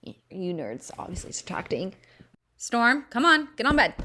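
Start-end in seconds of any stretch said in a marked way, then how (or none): 2.6-4.0 higher, louder call to a pet; calling the dog. Real, not acted. Calling her dog Storm onto the bed, louder and higher.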